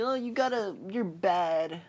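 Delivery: high-pitched mock aunt scolding. Aunt telling off her nephew, high mock scolding. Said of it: "his aunt's like".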